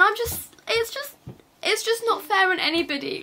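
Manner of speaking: high, faltering upset voice; real upset. Real, not acted. Teen mum telling viewers about hate she gets, upset. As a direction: falter as if close to tears. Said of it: "sat here crying upset".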